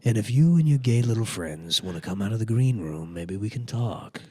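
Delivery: measured villain actor, slowing and softening; Alec Baldwin. Acted. Comic as the Green Room villain addressing trapped band, measured impression. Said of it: "is, is Alec Baldwin".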